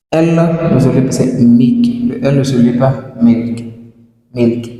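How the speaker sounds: ordinary talk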